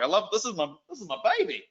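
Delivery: ordinary talk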